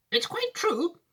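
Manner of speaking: high, fond Wonka voice; an old showman sharing a grand surprise; kindly Wonka. Acted. Wonka telling Charlie he gets the factory, high and fond.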